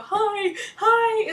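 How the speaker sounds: high, loud mock greeting from a ride; calling out hi. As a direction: call out in a high, excited squeal. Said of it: "and you go".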